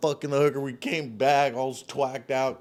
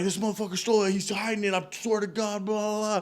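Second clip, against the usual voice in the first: angry accusation; 7 semitones higher, faster than usual. Retold or imitated. Teddy accusing the kid.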